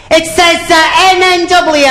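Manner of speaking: very high comic panic; panicked reading. Acted. Panicking novice reading the clock to the controller. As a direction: read it out in a high, alarmed voice.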